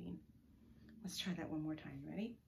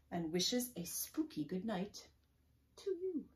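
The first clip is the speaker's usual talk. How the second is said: spooky storybook ending; 6 dB louder, slower than usual. A narrator's reading. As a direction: read it slowly, lifting up on the last words.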